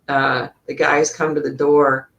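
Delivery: normal talking voice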